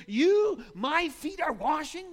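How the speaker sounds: high, loud, disbelieving cry; shocked Peter. Retold or imitated. Preacher voicing Peter's shocked cry at Jesus. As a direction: blurt it out in total shock. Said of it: "the words are even more emphatic".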